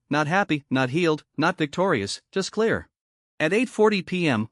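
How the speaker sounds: ordinary delivery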